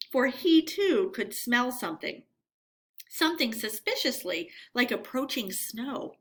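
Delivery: plain reading voice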